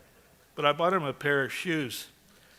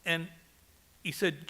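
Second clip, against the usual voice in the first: ordinary talk, nothing marked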